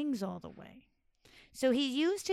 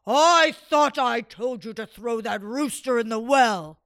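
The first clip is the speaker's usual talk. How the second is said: louder scolding sultan; angry sultan; 12 dB louder than usual. Acted. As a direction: scold the servants.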